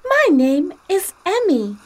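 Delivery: Emmy the talking straw greets Kim. Acted. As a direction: play a tiny plastic straw introducing herself. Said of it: "the straw said to Kim".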